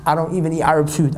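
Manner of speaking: ordinary talk, nothing marked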